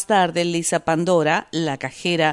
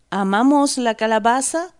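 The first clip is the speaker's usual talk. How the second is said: 'Amamos la calabaza' pitched high and louder, rising at the end; incredulous protest; 6 semitones higher, 4 dB louder than usual. A narrator's reading. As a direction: throw 'Amamos la calabaza' back as a disbelieving question. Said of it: "protesta ella".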